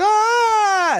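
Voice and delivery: high, loud shriek; startled scream. Retold or imitated. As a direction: let out a sudden shriek. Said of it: "she's like".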